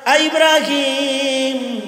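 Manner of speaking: slow, drawn-out call to his son; father calling Ibrahim. Acted. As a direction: stretch the name across the yard.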